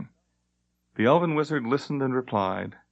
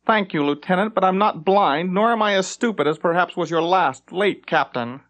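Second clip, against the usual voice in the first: raised, sneering voice of a vain elf captain; haughty captain; 7 semitones higher, 3 dB louder than usual. Acted. Elf captain snubbing his lieutenant, haughty and raised. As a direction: talk down to him, loud and high.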